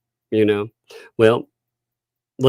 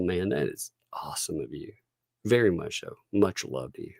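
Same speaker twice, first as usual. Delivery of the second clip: whispered thanks; 4 semitones lower, 11 dB quieter than usual. Real, not acted. Thanking donor.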